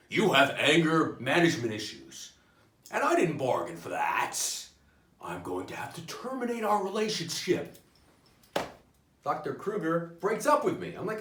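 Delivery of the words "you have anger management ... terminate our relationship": indignant therapist. Acted. Therapist dumps patient, acted quarrel.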